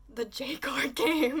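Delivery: loud, slow, high squeal through laughter; thrilled announcement. Retold or imitated. Trading partner announcing the card arrived, thrilled. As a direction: burst out with the news, laughing.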